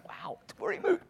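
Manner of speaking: breathless starstruck whisper; awed whisper. Retold or imitated. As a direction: whisper it, starstruck at meeting him. Awed aside at meeting a celebrity.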